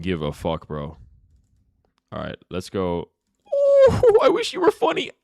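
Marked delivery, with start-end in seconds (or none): 3.5-5.1 loud falsetto mockery, very high; exaggerated high-pitched imitation of an offended chatter; mocking chatter. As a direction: squeal it back in a silly voice. Mocks the chat message read earlier.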